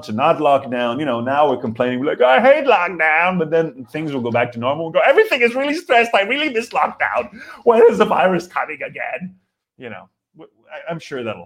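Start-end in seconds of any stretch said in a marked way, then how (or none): 2.2-3.4 high, drawn-out whine; exaggerated griping; mock complaint. Acting out people complaining. 5.0-9.4 high-pitched hammed-up griping; exaggerated plaintive moan; mock whining. Acting out future complainers.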